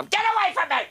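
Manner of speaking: sudden high mock shriek; high hammed-up outburst; mom snapping. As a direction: yell it like a cranky old mother. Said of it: "And she'd go".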